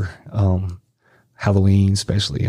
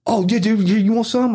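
high, eager mock stoked voice; hyped passenger impression, pitch up; imitated coked-up passenger; 12 semitones higher than usual. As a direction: offer it high and keyed-up.

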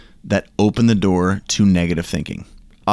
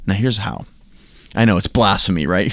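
ordinary talk, nothing marked

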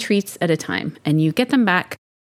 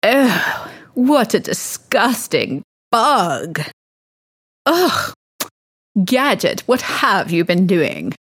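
disgusted mistress; 2 semitones higher, slower than usual. Acted. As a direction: play an appalled mistress recoiling, then scolding. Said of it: "Mistress looks over and she goes".

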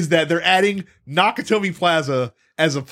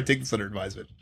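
quoting Hans Gruber; 8 semitones lower, 9 dB quieter, much faster than usual. Retold or imitated. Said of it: "Here I am misquoting Die Hard".

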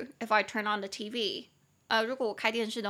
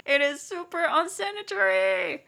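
high, drawn-out mock horror; pitched-up comic wail; disgusted outcry; 9 semitones higher, 5 dB louder, slower than usual. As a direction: cry out in exaggerated disgust. Recalling disgust at student eating dropped food.

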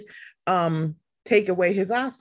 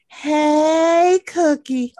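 higher, louder sing-song hello; Taria's greeting; 5 semitones higher, 6 dB louder, much slower than usual. Retold or imitated. Host greets guest Cookie the way Taria does, a higher, louder hello. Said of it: "I gotta say it like Taria".

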